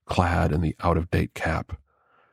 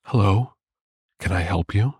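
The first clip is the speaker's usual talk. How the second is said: soft purring voice; 2 semitones higher than usual. A narrator's reading. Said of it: "in a soft, almost purring voice".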